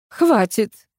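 cutting him off. A narrator's reading. Administrator interrupting Vasya's grumbling, curt. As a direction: shut the chatter down firmly. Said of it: "оборвала его Наина Львовна".

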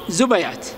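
زبيات said zubayāt, short a after the b; middle opened with a. Shown as a demonstration. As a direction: put an a between b and y in زبيات. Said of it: "إما التسكين أو الفتح". (زبيات بفتح الباء)

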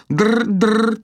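high, loud insistent trill; demanding phone-ring imitation. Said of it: "требовательно повторила Ирис".

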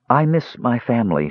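usual speaking voice

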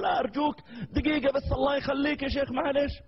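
put-on the young fan implores the sheikh to stop, high and pleading; begging fan. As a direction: beg him for just a minute.

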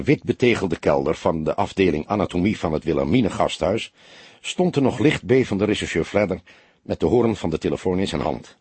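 usual speaking voice

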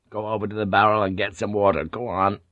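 ordinary delivery

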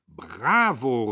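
loud cheer; swelling, applauding cry; calling out. A narrator's reading. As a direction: shout your approval. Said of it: "rief Jadassohn".

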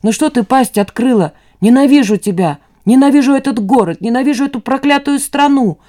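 hateful outburst, pitch climbing; angry rant. A narrator's reading. Son raging at his mother and the country, angry rant. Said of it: "орёт Костик".

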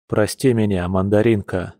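ordinary delivery, nothing marked

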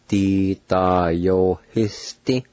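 "Di da yo he sti" slowly joined with held vowels; "Di da yo he sti" slow and evenly measured; slow pronunciation demo. Shown as a demonstration. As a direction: stretch "Di da yo he sti" out for learners. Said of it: "now pronounce these words".

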